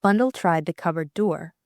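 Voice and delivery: usual speaking voice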